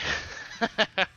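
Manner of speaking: short burst of laughter; amused laugh. Real, not acted. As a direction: crack up briefly at the question. Reacts to question about clowns.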